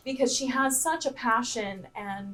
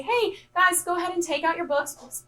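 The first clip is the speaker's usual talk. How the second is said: calling out to the class, higher; teacher getting the class started; 7 semitones higher than usual. Retold or imitated. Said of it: "I say".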